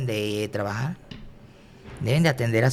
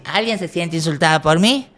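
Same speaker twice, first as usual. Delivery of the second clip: called out louder to the whole room, rising at the end; louder to the crowd; 4 semitones higher, 5 dB louder than usual. Retold or imitated. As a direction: ask the audience loudly, playing it up. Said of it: "Así".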